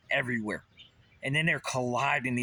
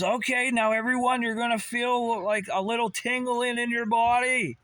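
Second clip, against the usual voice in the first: lightly mocking a calm instructor, pitched way up; imitating Wim Hof; 12 semitones higher, 3 dB louder than usual. Ranter imitates Wim Hof coaching people to feel tingling, in a high mock guru voice. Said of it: "He says".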